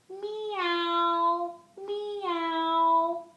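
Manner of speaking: high, drawn-out pretend meows; kitty meow. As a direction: mew like a kitty, gliding down. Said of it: "The kitty says".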